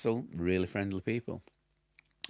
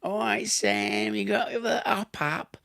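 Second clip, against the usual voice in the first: high, put-on Cockney plasterer; mock Cockney; 11 semitones higher, slower than usual. Cockney plasterer calling to workmate Sam on the job, mock Cockney accent. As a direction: take off a Cockney workmate, pitched well up. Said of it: "your Cockney people".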